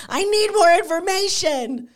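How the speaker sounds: very high-pitched, exaggerated plea; mock desperate squeal. Fan wanting news of the comeback, high mock desperation. Acts out impatient reaction to vague posts.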